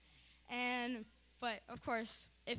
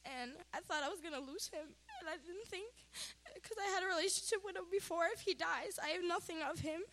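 choked up, higher voice; tearful; 5 semitones higher than usual. Real, not acted. Daughter recalling her father's three strokes, tearful. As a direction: fight back real tears while speaking.

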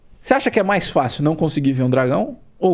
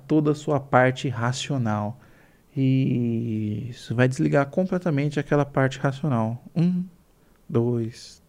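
slow, soft hypnotist voice; hypnotic induction; 4 semitones lower, 4 dB quieter, slower than usual. Acted. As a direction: lull them down into trance.

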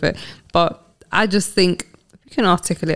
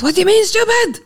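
mum freaking out. Retold or imitated. Mum shrieking at her daughter for saying stupid. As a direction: shriek it like a shocked mother. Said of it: "She goes".

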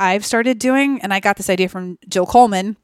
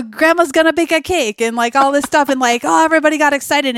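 excited family; 7 semitones higher, 4 dB louder than usual. Retold or imitated.

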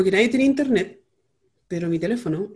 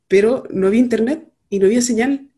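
normal talking voice

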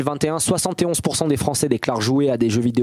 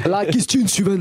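hint of a Marseille accent, higher and lively; accent marseillais; 7 semitones higher than usual. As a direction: toss off a quick Marseille accent. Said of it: "Avec l'accent marseillais maintenant". (accent marseillais léger et enjoué)

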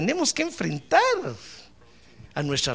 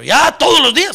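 louder, higher objection; balking protest; 7 semitones higher, 8 dB louder than usual. Acted. Helper complaining to pastor about the duty, balking protest. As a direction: protest at the unwelcome daily chore.

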